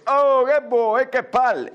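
high, whining comic grumble; hammed-up exasperated reply; fed-up huntsman. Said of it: "E il cacciatore".

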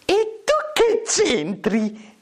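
very high, slow falsetto; high cooing comic girl. Acted. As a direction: ask in a drawn-out girlish falsetto. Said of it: "chiese Biancaneve".

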